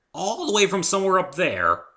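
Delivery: high and loud astonishment; stunned, pointing skyward; incredulous. Acted. Dave to Fluttershy, high and loudly amazed.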